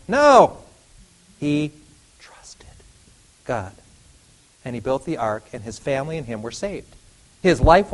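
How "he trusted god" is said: hushed, breathy whisper. Acted. Preacher to the church, a hushed whisper. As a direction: lean in and whisper it.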